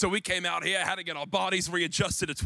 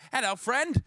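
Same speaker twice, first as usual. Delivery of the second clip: higher-pitched put-on British greeting; chirpy British-sounding imitation, pitched up; mock British accent; 8 semitones higher, 3 dB louder than usual. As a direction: imitate a local in accidental British. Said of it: "why I sound British there".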